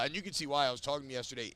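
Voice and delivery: ordinary talk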